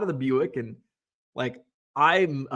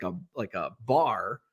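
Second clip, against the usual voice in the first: normal talking voice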